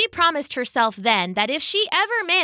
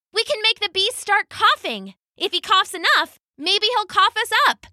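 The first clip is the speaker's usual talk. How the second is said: excited idea; 12 semitones higher than usual. Acted. Kaya voiced, excited idea.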